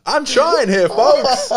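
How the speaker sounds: mock plea. Real, not acted. Comic plea to audience.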